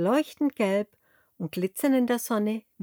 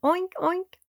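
very high contented oinks; happy piglet grunts; 10 semitones higher than usual. A narrator's reading. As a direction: grunt with delight. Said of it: "grunzt vergnügt".

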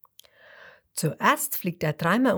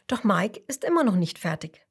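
ordinary delivery

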